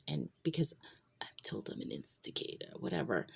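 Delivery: confiding half-whisper to the camera; whispered aside. Real, not acted. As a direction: drop to a secret whisper for the aside. Whispered aside about herself.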